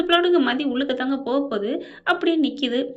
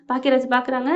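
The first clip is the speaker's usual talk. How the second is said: plain reading voice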